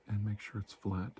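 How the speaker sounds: ordinary delivery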